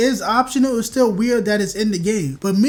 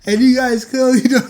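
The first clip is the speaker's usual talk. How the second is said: louder, laughing mid-sentence; breaking into laughter; 3 semitones higher, 5 dB louder than usual. Real, not acted. Laughing fit.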